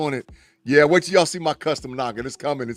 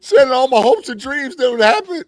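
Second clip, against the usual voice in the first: laughing fake crying; 7 semitones higher, 3 dB louder than usual. Real, not acted. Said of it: "I'm about to start crying".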